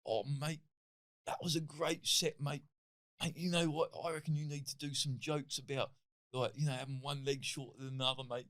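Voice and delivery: a hint of a chatty Cockney geezer; Cockney promoter. Promoter after the gig, praising the set in a light Cockney accent. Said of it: "like, Cockney geezer British".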